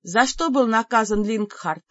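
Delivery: ordinary delivery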